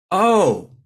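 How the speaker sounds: imagined aha moment; dawning realisation. Acted. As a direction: let the penny drop on it.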